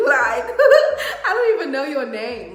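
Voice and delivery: loud, incredulous laughing outburst. Real, not acted. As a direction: crack up loudly through the words. Cracking up at her own comeback.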